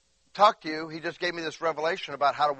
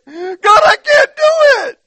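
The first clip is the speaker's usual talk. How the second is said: very high, loud wail of helplessness; mock crying; 15 dB louder, slower than usual. Preacher acts out admitting he can't, loud mock crying. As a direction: bawl it out to God, hammed up. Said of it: "I start whining and crying".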